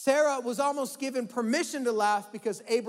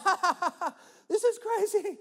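mock bitter laugh, much higher; 9 semitones higher than usual. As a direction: scoff it out with a sour little laugh. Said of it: "bitter amusement".

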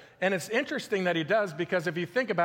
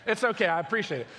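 normal talking voice

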